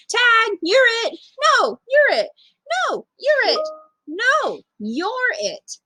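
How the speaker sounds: put-on high, playful child voices trading taunts; children bickering over tag; kids playing tag. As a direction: squeal it like two boys at play.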